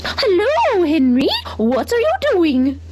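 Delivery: high, slow cow voice calling out; a friendly cow greeting the pig; voicing the cow. A narrator's reading. Cow Abigail calls out to Henry, high and friendly.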